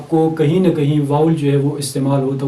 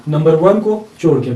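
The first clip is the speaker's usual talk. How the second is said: ordinary delivery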